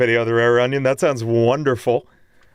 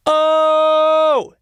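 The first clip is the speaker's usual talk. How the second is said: strained high note; 13 semitones higher, 5 dB louder than usual. Shown as a demonstration. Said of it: "it was a stretch for me".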